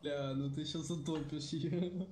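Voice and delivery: ordinary talk, nothing marked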